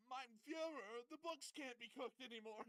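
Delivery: high, loud mock panic; panicked yell. Accountant bursting in on Putin, a high, loud, frantic yell. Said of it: "yelling in Russian".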